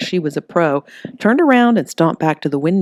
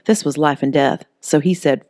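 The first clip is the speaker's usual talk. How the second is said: usual speaking voice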